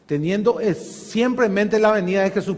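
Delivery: normal talking voice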